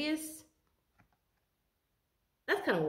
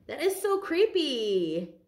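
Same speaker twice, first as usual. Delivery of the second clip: creeped out; 6 semitones higher, 5 dB louder, much slower than usual. Real, not acted. Reacting to creepy card message.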